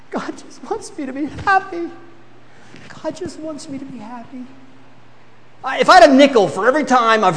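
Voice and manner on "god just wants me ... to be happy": mock whiny excuse. Shown as a demonstration. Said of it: "Read it to me".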